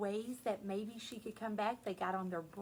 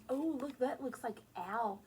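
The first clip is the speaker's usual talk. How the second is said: normal talking voice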